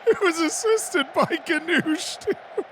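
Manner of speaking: high, slow, laughing talk. Real, not acted. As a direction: giggle through the words. Laughing at own joke.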